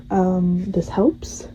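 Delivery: ordinary delivery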